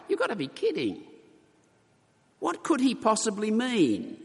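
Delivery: high, disbelieving you've got to be kidding; incredulous. Retold or imitated. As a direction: sound like a baffled listener who can't believe it. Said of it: "responding with something like".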